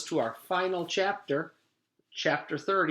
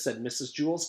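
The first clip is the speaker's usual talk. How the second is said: usual speaking voice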